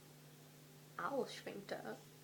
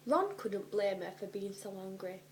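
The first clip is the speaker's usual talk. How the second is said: ordinary delivery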